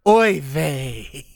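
put-on light Yiddish accent, oy vey; a touch of Yiddish lilt.

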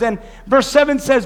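usual speaking voice

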